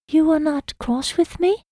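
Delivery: high, lilting Hannah, hoping he isn't angry; hopeful lilt. A narrator's reading. Hannah asks her wounded love if he's cross, hopeful lilt. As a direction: lift the question on a thread of hope. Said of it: "Her words lilted as if hope anchored her".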